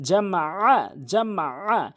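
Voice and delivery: জামা আ with the আ squeezed from mid-throat; pressed throat ayn. Shown as a demonstration. Teacher drilling the ayn, final আ pressed from mid-throat. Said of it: "হলকের মাঝখান থেকে চাপ দিয়ে".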